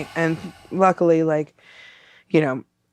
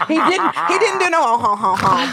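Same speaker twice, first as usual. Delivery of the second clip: laughing; 9 semitones higher than usual. Real, not acted. Laughing through the story.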